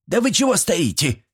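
put-on sharp high-pitched old-woman bark; bossy granny's high scolding; barked reproach. A narrator's reading. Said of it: "рявкнула Степанида".

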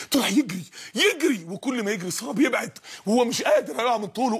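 ordinary delivery

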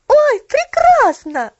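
very high, loud, slow delighted cry; joyful exclamation. A narrator's reading. Girl delighted at her idea, joyful cry. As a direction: burst out with joy. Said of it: "воскликнула она радостно".

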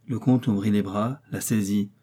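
usual speaking voice

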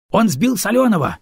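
high, loud alarmed yell. Acted. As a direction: shout it out across the gunfire. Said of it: "орёт в бойницу якорь".

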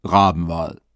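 low, gruff self-introduction; deep voice. A narrator's reading. Stranger introducing himself to Leo, low and gruff. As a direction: drop the voice low and announce the name. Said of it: "sagte mit tiefer Stimme".

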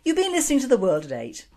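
quick, high announcer sign-off; radio sign-off. Acted. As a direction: close the show like a radio host, brisk and bright. Show sign-off.

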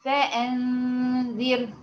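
فأنذر drawn out very slowly, the ra kept light; slow stretched فأنذر; tajweed demo word. Shown as a demonstration. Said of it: "زي كلمة".

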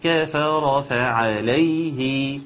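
كفر فعليه chanted slowly, every fatha whole; full fathas. Shown as a demonstration. Teacher reassuring a student, slow chant. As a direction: keep each fatha of كفر فعليه complete. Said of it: "مفيش تقطيع ولا حاجة".

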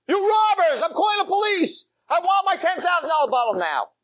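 put-on high, shouting drunk; drunk outrage. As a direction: rage at the owner through tears. Drunk threatens to call police.

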